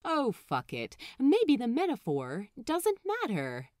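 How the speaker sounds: usual speaking voice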